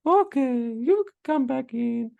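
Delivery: very high, put-on mama voice; hammed-up mother caving to her sons; imitating relenting mother. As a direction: give in, in a slow mock falsetto. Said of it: "she's gonna be like".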